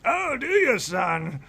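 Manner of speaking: put-on high, scoffing old elf; mocking. Elder mocking the stranger who called him son. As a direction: sneer at the youngster.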